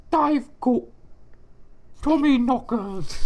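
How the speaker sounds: high, put-on British accent, hammed up; mock British accent. Friend mocks [name]'s fake accent in high British voice. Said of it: "as she might say".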